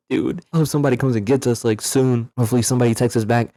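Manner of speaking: hurried, nervous imitation of a friend; mock-anxious friend talking fast; worried friend, rushed. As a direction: rattle it off like a lightly voiced anxious buddy. Quoting worried friend Alex.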